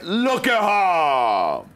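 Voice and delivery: long drawn-out showy call; hammy, held-out announcer call; game-show catchphrase. Pretend. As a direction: stretch every word like a game-show host. Host calls the catchphrase.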